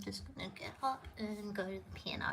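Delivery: nearly whispered, quick aside; hushed aside. Real, not acted. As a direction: murmur it under your breath. Aside while leaving the desk.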